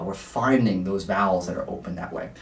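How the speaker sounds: ordinary delivery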